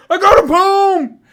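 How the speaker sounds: high, drawn-out bellow imitating a drunk heckler; very loud, insistent mock yelling; drunken fan yelling. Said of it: "he starts yelling".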